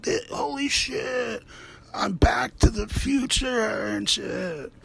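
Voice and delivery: high, slow gasp of disbelief; amazed shout. Retold or imitated. Mother seeing her grown son from the future, slow high amazement. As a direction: gape at it slowly, high and amazed. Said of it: "And be like".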